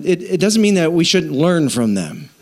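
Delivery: ordinary delivery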